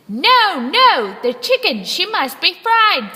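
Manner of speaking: high, loud quarrelsome pirate; gold-toothed pirate insisting, high and loud; arguing pirate. Acted. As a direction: overrule the others, loudly. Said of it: "said a gold-toothed pirate".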